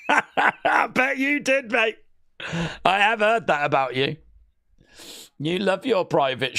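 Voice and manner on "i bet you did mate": slow, laughing through the words; laughing tease. Real, not acted. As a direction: chuckle it out teasingly. Teasing friend, laughing harder.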